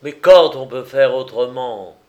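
loud, indignant exclamation; angry outburst. A narrator's reading. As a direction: burst out loudly. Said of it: "éclatant, s'écria".

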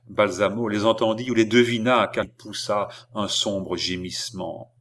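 usual speaking voice

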